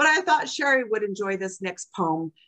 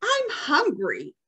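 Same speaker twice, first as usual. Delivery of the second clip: higher, slow whiny grumble; grumbling toddler; 7 semitones higher, slower than usual. Retold or imitated. Grandson complaining he's hungry, mock grumble. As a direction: whine it like a hungry little kid. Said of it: "he grumbles".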